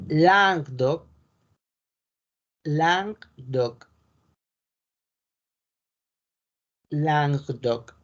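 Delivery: Languedoc as langdoc, slow and careful; French pronunciation. Shown as a demonstration. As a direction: model Languedoc slowly, French style. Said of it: "Languedoc en español es". (Languedoc lento a la francesa)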